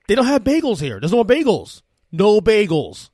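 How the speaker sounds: put-on loud, high explaining to a toddler; exasperated parent. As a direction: spell it out loudly, dragging the final refusal. Exasperated parent explaining to three-year-old.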